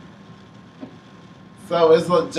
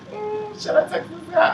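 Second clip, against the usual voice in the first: mock inner voice. Retold anxious inner monologue.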